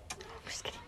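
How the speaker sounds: breathy whispered aside. Real, not acted. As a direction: whisper it, just kidding. Whispered aside after joke.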